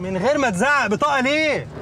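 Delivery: annoyed, insistent comeback; irritated retort. Acted. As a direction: push back indignantly at being yelled at. Snapping back at a shouting guard.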